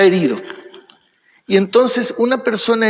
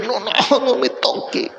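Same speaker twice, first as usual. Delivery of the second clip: higher, softer acted plea; mock pained protest; 5 semitones higher, 3 dB quieter than usual. Hurt person to someone pulling out a thorn, mock pained protest. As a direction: imitate someone shrinking from a painful touch. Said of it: "si alguien quiere sacarle".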